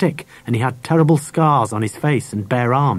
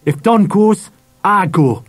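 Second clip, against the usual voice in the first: higher-pitched, firm ultimatum; defiant boy; 7 semitones higher, 4 dB louder, slower than usual. A narrator's reading. Harry stands up to Dad for his dog, defiant. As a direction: stand your ground.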